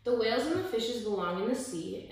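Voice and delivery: plain reading voice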